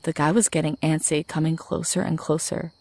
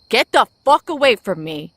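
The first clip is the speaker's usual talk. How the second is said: higher, louder panicked scream; desperate yell; 5 semitones higher, 7 dB louder than usual. Acted. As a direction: yell it out with everything left. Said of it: "I yelled as loudly as I could".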